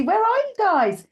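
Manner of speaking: high-pitched call to the viewers. Real, not acted. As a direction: call out for the missing crowd, pitch soaring. Calling to absent online viewers.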